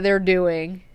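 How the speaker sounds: ordinary delivery, nothing marked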